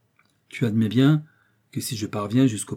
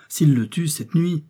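higher, louder, slow anxious echo; urgent alarm; 6 semitones higher, 5 dB louder, slower than usual. A narrator's reading. Kennedy grips the doctor's hand, alarmed. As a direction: press the fear on him, raised and drawn out. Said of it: "en saisissant la main du docteur".

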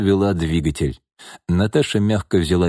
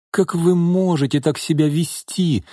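higher-pitched, mildly scornful question; contemptuous; 8 semitones higher than usual. A narrator's reading. Young woman reproaching the drunk mother, mildly contemptuous. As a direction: reproach her with a touch of disdain. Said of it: "презрительно спросила Наташа".

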